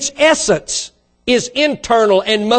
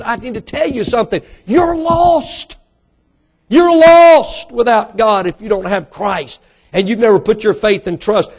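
raised, emphatic pulpit voice; impassioned preaching; 3 semitones higher, 3 dB louder than usual. Real, not acted. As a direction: proclaim it with urgent force. Preaching at full force.

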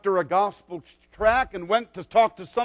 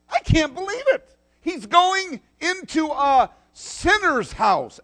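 high, indignant disbelief; gossiping crowd aghast; mock outrage; 8 semitones higher than usual. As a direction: play the scandalised crowd. Crowd murmuring against Jesus.

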